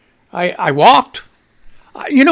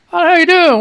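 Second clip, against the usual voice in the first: upbeat greeting; 10 semitones higher, 5 dB louder than usual. Acted. Greeting viewers energetically.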